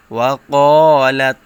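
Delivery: ওয়া ক্বালাত with the ক্বা held long and loud; four-count stretch. Shown as a demonstration. Teacher to students, demonstrating the long stretch loudly. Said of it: "চার আলিফ টান দিতে হবে".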